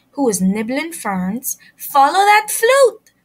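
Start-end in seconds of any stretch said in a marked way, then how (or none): 1.8-3.1 high, loud group call; yelled. A narrator's reading. Fifi and Frog yelling after the flute, high and loud. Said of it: "yelled Fifi and Frog".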